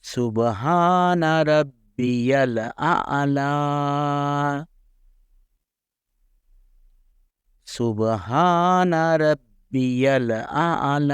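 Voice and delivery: high, slow سبحان ربی الاعلی with a strong throaty ع in الاعلی; exaggerated ain. Shown as a demonstration. Teacher exaggerating ain for students to repeat. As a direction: overstress the ع in الاعلی for practice. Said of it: "میں نے آئین کو زیادہ نمایاں کیا ہے".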